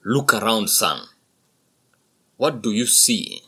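loud, steady father bull; buffalo father voice. A narrator's reading. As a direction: point out the herd proudly. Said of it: "father speaks to son".

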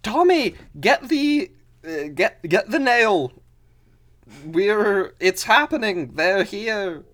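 urgent high-pitched holler; alarmed mother shouting indoors; yelling into the house. Acted. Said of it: "she yells back into the house".

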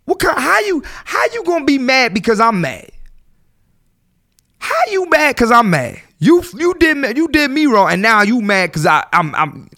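high, loud, exasperated; indignant rant. Acted. Host playing the wronged girlfriend, loud and indignant. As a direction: vent as the one who was wronged.

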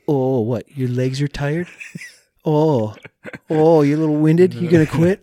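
high, loud, sneering mock sympathy; scoffing at his own tired legs, loud and high; mocking taunt. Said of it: "I'm like".

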